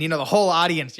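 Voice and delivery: usual speaking voice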